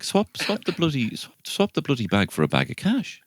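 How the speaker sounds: high, mock-preachy lecturing voice; ranting at the kids. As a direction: wag a finger at the kids in a squeaky voice. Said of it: "I'm like".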